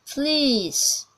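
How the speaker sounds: demonstrated British "fleece"; British accent. Shown as a demonstration. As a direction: stretch the ee of "fleece" in British English. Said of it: "e long".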